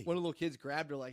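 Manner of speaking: usual speaking voice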